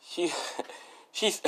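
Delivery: cracking up mid-sentence; helpless giggles cutting off the words; laughing. Real, not acted. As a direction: try to go on but break into real laughter. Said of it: "I'm laughing right now".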